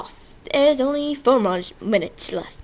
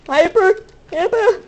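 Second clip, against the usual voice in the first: excited hyper; 6 semitones higher, 5 dB louder than usual. Shown as a demonstration. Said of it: "I am hyper".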